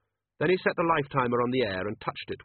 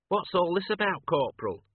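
much higher, bewildered constable; puzzled constable voice; 8 semitones higher than usual. Acted. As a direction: ask like a confused watchman.

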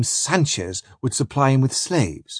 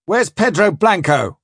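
raised, higher-pitched demanding question; calling out; 6 semitones higher than usual. Acted. Spring asking after Pedro Blanco, calling out. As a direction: call the question over to the canoe. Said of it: "cries Spring".

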